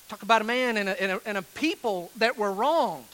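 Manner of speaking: ordinary talk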